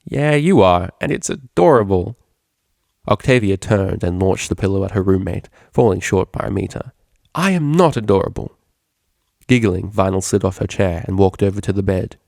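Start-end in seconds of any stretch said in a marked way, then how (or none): 0.0-2.2 teasing. Acted. Vinyl teases Octavia. 7.4-8.5 indignant. Acted. Octavia indignant.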